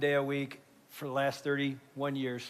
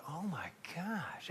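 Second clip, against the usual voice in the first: awed, softly; 11 dB quieter, slower than usual. Real, not acted. Father moved by son's answer.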